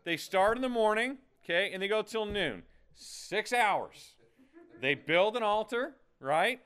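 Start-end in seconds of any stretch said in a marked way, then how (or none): none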